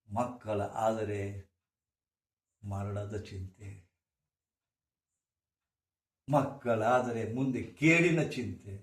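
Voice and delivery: slow solemn recitation; reciting vachana. Acted. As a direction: intone each line slowly, like verse. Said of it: "ಬಸವಣ್ಣವರು ಎಂದೋ ಹೇಳ್ಯಾರ". (ಬಸವಣ್ಣನ ವಚನವನ್ನು ನಿಧಾನವಾಗಿ ಪಠಿಸುವುದು)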